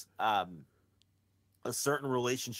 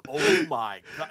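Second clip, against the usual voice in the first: mock awe; 3 semitones higher, 5 dB louder, slower than usual. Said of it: "looking at their friends like".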